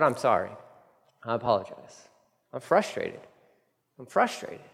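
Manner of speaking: soft, halting confession; subdued apology. Acted. Preacher modelling a return to apologize, softly contrite. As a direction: own up quietly, pausing between short admissions.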